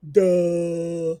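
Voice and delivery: mocking, obvious duh. Real, not acted. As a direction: say it like it's obvious.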